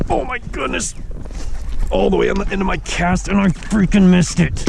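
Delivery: high, cries out in disbelief; frustrated exclamation. Real, not acted. Angler cries out after missing a fish at the end of his cast. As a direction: blurt it out as the fish gets away.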